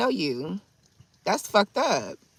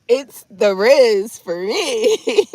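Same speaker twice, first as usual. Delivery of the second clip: loud, drawn-out hype with raised pitch; hyped-up flirty; 5 semitones higher, 9 dB louder, much slower than usual. Retold or imitated. Woman hyping up a smooth suitor, hyped-up flirty. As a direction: stretch it out loud in playful approval.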